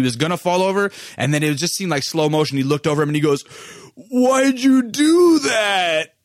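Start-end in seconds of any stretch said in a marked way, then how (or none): 3.9-6.1 high, very drawn-out mock wail; wounded protest. Punched kid looks over at his attacker, wounded protest. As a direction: stretch it out like slow motion, shouted.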